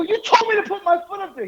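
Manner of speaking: very high, squealing protest; retold indignant protest. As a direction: act out being set up by a friend. Retold accusation at Billy.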